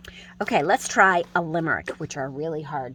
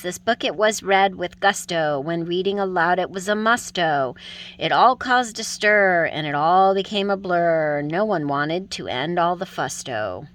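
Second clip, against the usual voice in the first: loud, bouncy limerick read with gusto; bouncy galloping verse; 5 dB louder than usual. Shown as a demonstration. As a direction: gallop through it with rhythm. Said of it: "So here it is".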